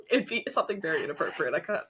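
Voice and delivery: ordinary talk, nothing marked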